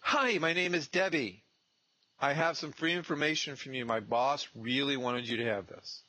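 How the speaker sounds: beam through a big smile and greet them; bright door-knock greeting. Shown as a demonstration. Intern greeting a homeowner with a huge smile, high and bright. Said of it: "and say".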